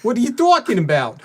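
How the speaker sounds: put-on high, loud New York accent. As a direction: play it like a Brooklyn tough guy. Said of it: "Your New York accent?".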